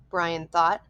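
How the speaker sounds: ordinary delivery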